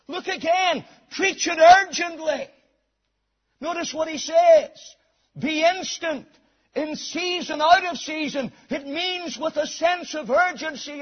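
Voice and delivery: high, urgent pulpit exhortation; full-force preaching. Real, not acted. Preacher urging clear, urgent preaching, full-force exhortation. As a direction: drive each command home from the pulpit.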